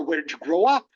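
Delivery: ordinary delivery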